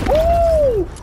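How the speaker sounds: loud high whoop; excited cheer. Real, not acted. Cheering in the game.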